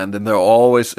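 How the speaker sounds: normal talking voice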